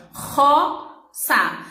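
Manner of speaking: "roça" slowly, opening on a long guttural R; strong throaty R. Shown as a demonstration. Teacher shows the strong R, "roça" slow with throaty R. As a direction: stretch "roça" into two slow parts, R rasped in the throat. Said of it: "O R é forte porque vem lá da garganta".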